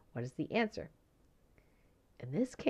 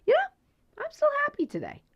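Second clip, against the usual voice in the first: high, bright voice, louder at first; happy mentee; 13 semitones higher, 7 dB louder than usual. Retold or imitated. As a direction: sound cheerfully unbothered by a stolen car. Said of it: "He's like".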